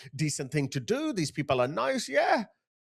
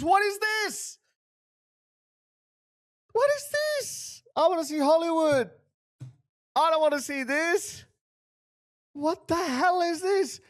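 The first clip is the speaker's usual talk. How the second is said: much higher, exasperated disbelief; outraged, much higher voice at the street scene; incredulous outrage; 10 semitones higher than usual. Real, not acted. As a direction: pitch it up like you can't believe your eyes.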